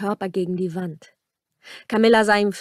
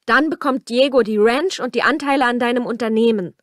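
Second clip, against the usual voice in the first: higher, louder protest with some desperation; desperate cry; 4 semitones higher, 6 dB louder than usual. A narrator's reading. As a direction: call out an urgent last argument. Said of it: "rief Camilla verzweifelt".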